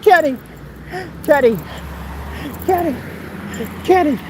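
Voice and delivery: high, plaintive calls with pauses between; hammed-up wailing after someone leaving; mock crying out. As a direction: cry the name out the window, imitating desperation. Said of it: "Out the window crying, calling my name".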